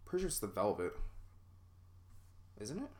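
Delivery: normal talking voice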